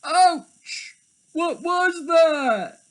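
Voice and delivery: high, slow pained yelp; high hurt waking cry; startled leopard. Acted.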